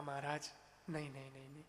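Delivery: soft, low plea to stay; hushed, deferential entreaty; gentle pleading. Acted. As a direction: hold them back quietly, haltingly. Woman pleads saints to stay.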